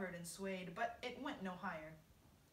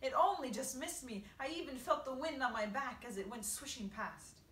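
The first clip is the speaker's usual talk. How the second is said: higher, agitated complaint; wailing; 4 semitones higher, 7 dB louder than usual. A narrator's reading. Earthworm describing the near miss, wailing. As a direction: protest it in a raised voice. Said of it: "wailed the earthworm".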